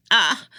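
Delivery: sharp loud yelp of shock; a sudden choked scream; startled cry. A narrator's reading. Girl grabbed by the throat through the bars, startled cry.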